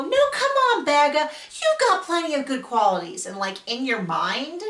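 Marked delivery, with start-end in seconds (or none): 0.0-3.2 mock protest. Imagined protest from viewer's mind.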